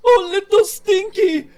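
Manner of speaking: high, loud sobbing cry of joy; tearful reunion. Acted. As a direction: wail it through happy tears. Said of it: "Sugo's sort of like".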